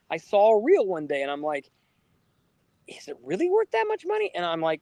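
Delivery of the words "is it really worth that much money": incredulous. Retold or imitated. Doubting a Sonic figure's price.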